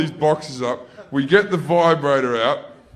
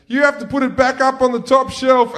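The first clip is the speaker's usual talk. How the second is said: put-on high, loud kid's call; taunting big brother; 10 semitones higher, 6 dB louder than usual. Older brother dumping the job on little brother, loud taunt. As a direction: call it out before running off.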